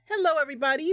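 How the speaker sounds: ordinary talk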